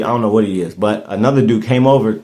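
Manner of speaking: normal talking voice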